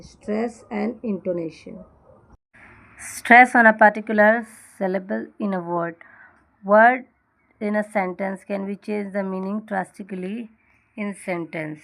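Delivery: slow, careful reading of a definition; textbook reading. Acted. Teacher slowly reads the definition to her class. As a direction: read the rule out slowly for students.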